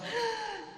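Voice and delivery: a high startled gasp; imitated owner's frightened gasp; sharp gasp. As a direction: gasp as if a dog appears. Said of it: "whose owner goes".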